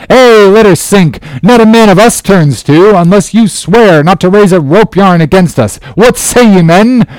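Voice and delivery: high, forceful rallying call; rally the men against the captain; defiant cry. A narrator's reading. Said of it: "cried Steelkilt".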